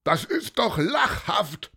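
high, derisive shout; scornful bellow. A narrator's reading. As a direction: shout down the boy's claim. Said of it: "brüllte jemand".

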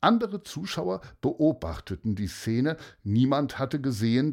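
plain reading voice

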